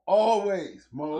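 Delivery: loud closing chant; loud sign-off. Acted. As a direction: belt out the sign-off. Ending the show loudly.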